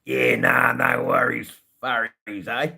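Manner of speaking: loud, high, hammed-up Australian accent; over-the-top Aussie declamation; Australian slang stanza. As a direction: belt it out in exaggerated broad Australian. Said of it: "That's a stanza".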